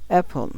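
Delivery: Apple with a high, punched app; emphatic app, falling away in Apple; stress on first syllable. Shown as a demonstration. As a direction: hit the start of Apple hard. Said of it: "এটা একটু জোরে বলতে হবে".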